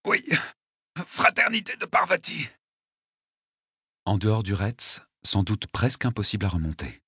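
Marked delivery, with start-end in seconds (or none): 0.1-2.5 high, strained gasp; pained confession. Acted. Wounded attacker admitting his brotherhood, gasping in pain. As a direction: force the admission out in pain.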